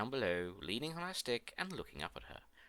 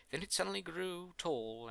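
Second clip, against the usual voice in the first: ordinary delivery, nothing marked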